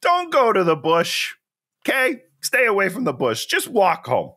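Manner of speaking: high-pitched, insistent warning; urgent advice pitched well up; exasperated pleading. Pretend. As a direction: plead with an imagined woman to stay safe. Urgent advice to imagined woman.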